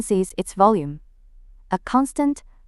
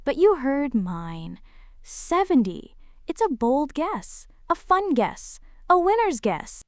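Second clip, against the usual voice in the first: smug show-off, bragging about its guess; smug boastful bot. Acted. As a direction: boast like a cocky game-show contestant. Bot boasting, bold winner voice.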